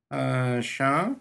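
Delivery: lightly voiced, uncertain call for attention; hesitant companion. A narrator's reading. Jurten hesitantly calls Shah.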